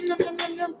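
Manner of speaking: cheerful high nomming over the food; playful nom noises. Real, not acted. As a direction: squeak it out like a happy eater. Playful eating noises while cooking.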